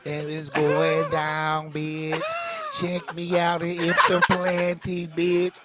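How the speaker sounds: slow, drawn-out mock promo delivery; mimicking a rapper. Comic imitating a rapper's promo video, slow mimicry. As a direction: drag every word out like a clueless rapper. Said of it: "said some shit like".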